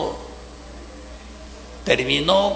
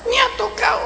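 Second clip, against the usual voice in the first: tearful. Retold or imitated. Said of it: "Viene y me abraza llorando".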